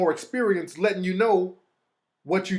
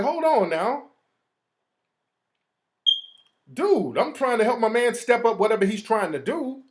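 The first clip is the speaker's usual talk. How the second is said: re-enacted indignant protest, raised pitch; higher-pitched, affronted retort; pissed-off objection; 5 semitones higher than usual. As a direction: object as if mildly riled. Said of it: "I'm getting a little pissed off now".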